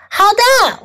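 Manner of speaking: high-pitched answering call; yelling back. A narrator's reading. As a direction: call back high without full volume. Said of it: "我喊回去".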